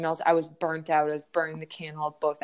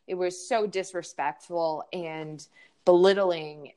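usual speaking voice

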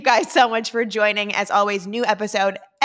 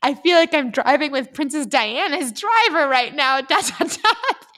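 reading while cracking up, pitch jumping higher; laughing through it; 6 semitones higher, 3 dB louder than usual. Real, not acted. Daughter reads mom's text aloud, laughing through it. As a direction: giggle your way through the text.

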